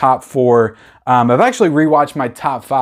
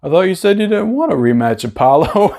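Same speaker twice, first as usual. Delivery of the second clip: higher, put-on Rocky voice with a teasing rise; Rocky impression; 5 semitones higher than usual. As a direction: imitate Rocky needling Apollo. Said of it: "And Rocky's just like".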